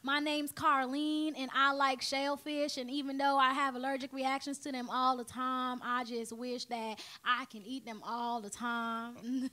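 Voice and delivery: slow Southern drawl; leisurely, thick Southern belle accent. Shown as a demonstration. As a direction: stretch the words out in a Southern accent. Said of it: "Even with Southern".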